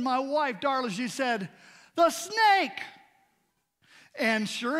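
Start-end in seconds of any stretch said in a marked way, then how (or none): 1.9-2.8 sudden high alarmed cry of the snake; startled cry. Retold or imitated. Wife warning everyone at the lake, high startled cry. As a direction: call out the warning, startled and loud.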